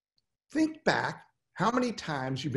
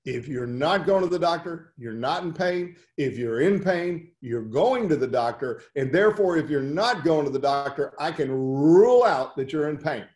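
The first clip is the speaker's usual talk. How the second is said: usual speaking voice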